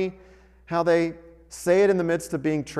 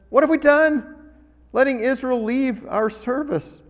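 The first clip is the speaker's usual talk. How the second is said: dramatic quote; 8 semitones higher than usual. Acted. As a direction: let the officials' alarm ring out. Pharaoh's officials' quote in reading.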